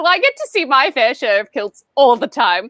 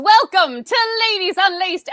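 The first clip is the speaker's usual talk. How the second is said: ordinary talk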